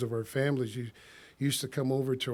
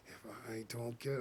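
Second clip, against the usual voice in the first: much softer muttered aside; under his breath; 5 semitones lower, 15 dB quieter than usual. Retold or imitated. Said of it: "swearing under his breath".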